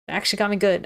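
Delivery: ordinary delivery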